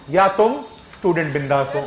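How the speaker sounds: ordinary talk, nothing marked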